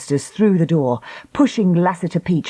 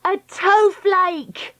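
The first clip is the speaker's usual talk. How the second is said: high, drawn-out gleeful shout; two girls crying out the answer; slow, high triumphant call; 14 semitones higher, much slower than usual. A narrator's reading. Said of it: "cried Dakota and Treacle in unison".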